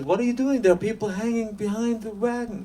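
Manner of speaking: aghast at what he sees; shocked protest. Acted.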